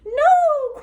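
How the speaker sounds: high, loud shriek of no; witch's cry. Acted. Witch cries out as the dragon chases her. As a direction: shriek it out in terror. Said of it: "cried the witch".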